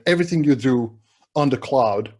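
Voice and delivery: ordinary talk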